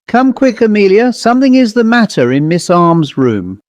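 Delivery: high, hurried summons; urgent call. Acted. Landlady urging her sister Amelia upstairs, high hurried call.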